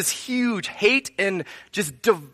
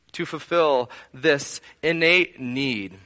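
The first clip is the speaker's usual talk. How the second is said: normal talking voice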